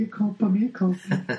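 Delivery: high-pitched hammed-up apology; comic panicky surrender; mock pleading. Acts out character's hands-up apology.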